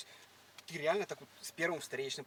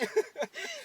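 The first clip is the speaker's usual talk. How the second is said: high loud laugh; 3 dB louder than usual. Real, not acted. After mocking her Tiffany quote. (громкий высокий смех)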